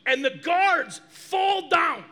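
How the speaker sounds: slow, loud shout at the climax; preached shout. Real, not acted. Preacher shouting to the congregation as the guards fall, loud and slow. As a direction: shout it out slowly to the back row.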